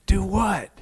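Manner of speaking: lightly acted confusion; puzzled retort. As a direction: toss it back, imitating bewilderment. Retells his puzzled reply to scolding.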